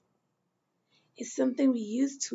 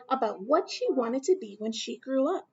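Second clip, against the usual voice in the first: usual speaking voice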